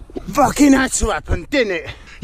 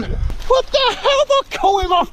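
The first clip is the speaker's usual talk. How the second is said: much higher, strained exasperation; high exasperated squeal; 12 semitones higher than usual. Real, not acted. Hiker fed up with his gear, high squeal. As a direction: squeal it in disbelief. Frustrated outburst, odd voice.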